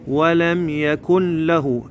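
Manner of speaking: slow recitation, يكن له merged as "yakul-lahu"; idgham. Shown as a demonstration. Teacher shows the merging rule, n melted into L. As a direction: chant slowly, folding the ن of يكن into the ل. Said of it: "هنا النون ويا اللام إدغام".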